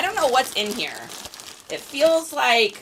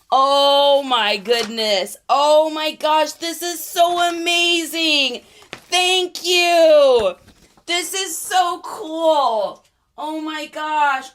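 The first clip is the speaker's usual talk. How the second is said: touched; 3 semitones higher, 4 dB louder, much slower than usual. Real, not acted. Overwhelmed by thoughtful gift.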